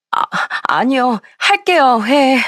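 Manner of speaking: high, whimpering surrender; tearful. A narrator's reading. Sister-in-law giving in to the scolding boss, tearful. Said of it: "그제서야 울먹거리며".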